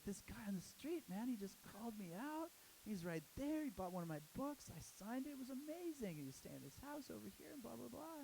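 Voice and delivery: high thrilled retelling. Retold or imitated. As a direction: gush about the fan. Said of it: "he's like".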